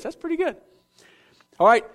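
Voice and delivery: ordinary talk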